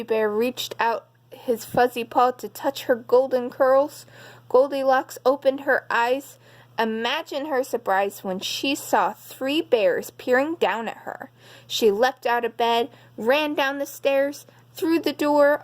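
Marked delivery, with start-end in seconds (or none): none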